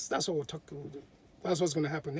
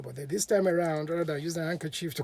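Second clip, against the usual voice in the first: plain reading voice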